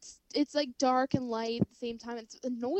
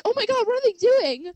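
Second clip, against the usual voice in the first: high, loud mock squeal of curiosity; playfully imitating eager fans; mock excited listeners; 10 semitones higher, 14 dB louder than usual. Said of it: "all of our listeners are like".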